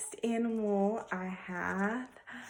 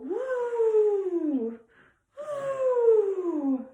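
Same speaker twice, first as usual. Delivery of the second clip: high loud trumpeting call; elephant trumpet; 14 semitones higher, 6 dB louder than usual. Shown as a demonstration. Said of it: "Can you do that?".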